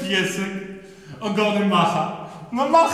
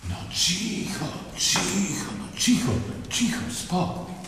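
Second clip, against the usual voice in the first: hushing; 6 dB quieter than usual. Acted. Francis calms the crowd.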